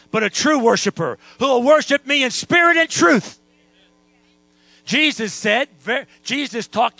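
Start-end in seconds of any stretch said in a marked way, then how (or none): 0.1-3.4 loud, raised preacher's proclamation; emphatic preaching. Real, not acted. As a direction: build to a ringing declaration. Preacher declaring God's call to worship.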